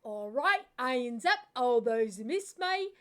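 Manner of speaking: loud, broad Cockney greeting, last line slow; Cockney accent. Retold or imitated. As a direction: call it out like a Cockney workman on site. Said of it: "we had this Cockney feller".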